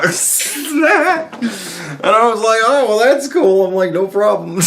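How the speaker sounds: high, loud words broken by laughter; can't stop laughing while retelling; laughing through it. Real, not acted.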